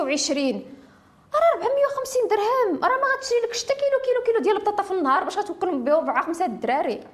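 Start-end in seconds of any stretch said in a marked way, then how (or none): 1.3-5.8 high, heated protest; indignant outburst. Real, not acted. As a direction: rise up in outrage over it. Indignant point about tiny alimony.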